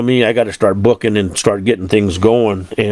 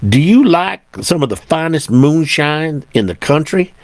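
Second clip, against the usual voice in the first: imitated Southern voice, pitched up; attempted Southern accent; 5 semitones higher than usual. Deputy offering moonshine to a truck driver, attempted Southern accent. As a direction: put on an amateur Southern accent, higher than usual. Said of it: "I can't do a Southern voice".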